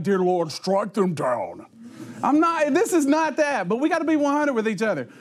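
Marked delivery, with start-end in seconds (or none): 0.0-1.7 mock preacher. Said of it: "call it out and go like".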